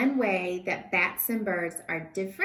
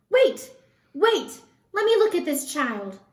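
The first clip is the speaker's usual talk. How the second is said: urgent call; 8 semitones higher, 8 dB louder than usual. Acted. Old bat pushes through crowd.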